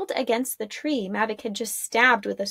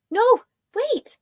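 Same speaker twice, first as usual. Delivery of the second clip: higher call after them; 13 semitones higher than usual. Acted. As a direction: call them back urgently. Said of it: "Arella called out".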